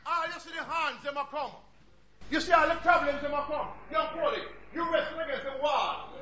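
loud, rhythmic Jamaican patois sermon; Jamaican patois preaching. Acted. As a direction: preach it in Jamaican creole with a laugh. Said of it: "I'm speaking in tongues here now".

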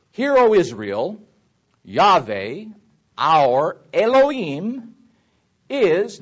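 loud, slow declamation in separate phrases; scripture reading. Shown as a demonstration. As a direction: proclaim the verse, drawing out each name. Recites the verse again.

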